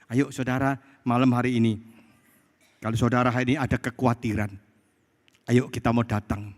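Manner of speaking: ordinary talk, nothing marked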